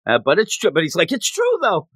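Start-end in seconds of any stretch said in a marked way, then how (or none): 1.1-1.9 mock insisting coach.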